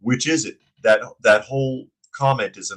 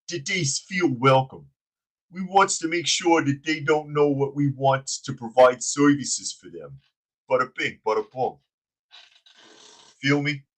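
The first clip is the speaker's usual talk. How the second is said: much higher mock wiseguy grammar, we wants; dumb henchman voice; 5 semitones higher than usual. Host mocking the mayor's welcome speech, henchman voice. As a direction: play the mayor as a goofy mob henchman. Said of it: "J J likes the accent".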